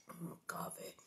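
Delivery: soft unvoiced whisper; whispered aside. Real, not acted. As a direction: breathe it to yourself. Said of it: "whispered aside".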